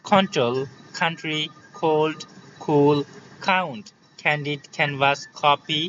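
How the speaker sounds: word list, "কন্ট্রোল" to "কপি", each k with a puff; separated model words, airy k as in "কাউন্ট"; aspirated K. Shown as a demonstration. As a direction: drill "কোল্ড" and "কুল" with kh onsets. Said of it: "ঠিক খ উচ্চারণ নিয়ে আসবে এগুলোর মধ্যে".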